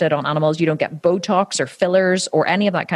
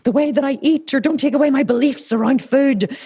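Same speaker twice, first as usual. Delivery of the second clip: higher, pleading, half-mocking panic; mock fearful plea; 7 semitones higher than usual. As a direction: play a scared eater begging, lightly. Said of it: "we get so fearful".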